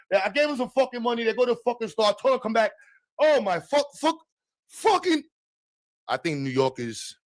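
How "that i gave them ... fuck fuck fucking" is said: put-on fast, high Italian New York accent rant; Italian New Yorker. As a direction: blow up like a Brooklyn Italian uncle.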